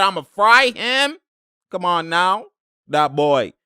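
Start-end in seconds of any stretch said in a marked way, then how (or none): 0.0-1.2 mock player boast. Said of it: "He said".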